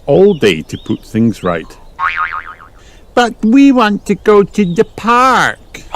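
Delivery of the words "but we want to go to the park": put-on high, drawn-out child protest; whining little-girl storybook voice; Peppa. A narrator's reading. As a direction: wail it in mock distress, pitched way up. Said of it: "cried Peppa".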